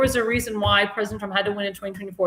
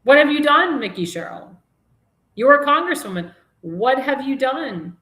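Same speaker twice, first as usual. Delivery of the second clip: indignant; 4 semitones higher than usual. Real, not acted. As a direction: demand answers from the governor. Host berates the governor.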